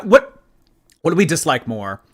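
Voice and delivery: usual speaking voice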